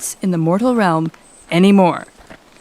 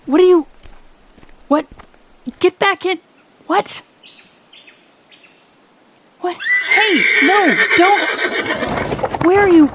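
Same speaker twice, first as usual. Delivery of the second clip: panicked calling after someone leaving; startled alarm; 11 semitones higher than usual. Acted. Alarmed as royal climbs out.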